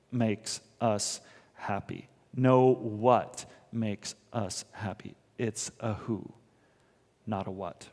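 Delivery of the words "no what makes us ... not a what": usual speaking voice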